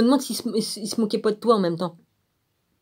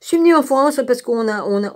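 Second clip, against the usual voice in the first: a light mocking take on the woman's story, pitched a touch higher; mocking imitation; 3 semitones higher than usual. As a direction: quote her sarcastically, as if telling tall tales. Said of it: "Ouais, on la connaît, celle-là". (imitation moqueuse, un peu plus aiguë)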